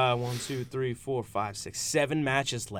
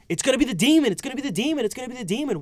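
high, loud sing-song taunt, said three times; mock the endless Demon hype in a high chant; mocking repetition; 11 semitones higher, 3 dB louder, faster than usual. Mocking the Demon hype to his cohosts, high sing-song chant. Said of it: "why do we have to say".

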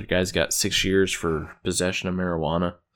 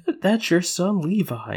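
woman's voice; 9 semitones higher than usual. Retold or imitated. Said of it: "She's like".

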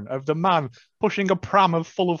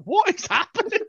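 disbelieving. Retold or imitated. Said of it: "I was like".

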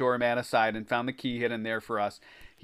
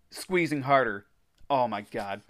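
ordinary delivery

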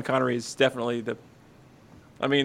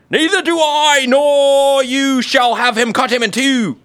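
much higher, louder, slowed British woman; spiteful mother; 10 semitones higher, 7 dB louder, slower than usual. Acted. The false mother answering the king, hammed-up spite. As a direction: spit it out with theatrical spite. Other mother's cold reply acted.

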